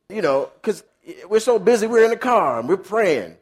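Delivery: normal talking voice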